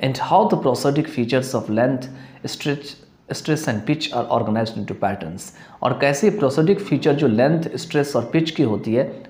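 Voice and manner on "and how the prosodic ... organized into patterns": slow, deliberate reading of a textbook definition; teacher dictating a definition slowly; slow dictation reading. Shown as a demonstration. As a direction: read it out word by word for students to follow. Reads the English definition aloud.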